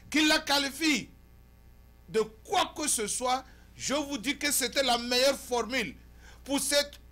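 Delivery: loud, high, emphatic oratory; forceful editorial monologue. Acted. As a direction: declaim to the viewers in punched phrases.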